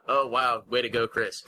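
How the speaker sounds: ordinary delivery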